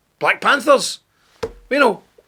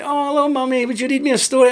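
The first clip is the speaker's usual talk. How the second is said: put-on much higher sweet child voice; mock little child; 7 semitones higher, faster than usual. Child asks parents for a bedtime story, mock sweet. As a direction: play the innocent kid asking for a story.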